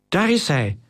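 contained, high cry of discovery; excited spotting. A narrator's reading. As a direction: point out the one you were hunting. Spotting the thief in the crowd.

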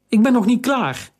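put-on high, insistent girl's voice; young girl's protest. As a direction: dig in and refuse like a stubborn girl. Girl resists being dragged away.

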